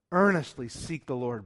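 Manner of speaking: ordinary talk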